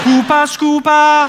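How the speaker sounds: high, slow Geordie chant; Geordie accent. Shown as a demonstration. Comic demonstrating Geordie key words, high drawn-out accent. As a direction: stretch both words in broad Newcastle. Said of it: "trying to do the Geordie accent".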